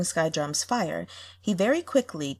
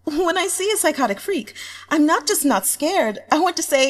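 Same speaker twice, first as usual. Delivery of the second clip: higher and louder, building giddy excitement; excited fan comment; 7 semitones higher, 5 dB louder than usual. Acted. A fan confessing her new taste, building excitement. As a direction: gush faster and higher as it builds.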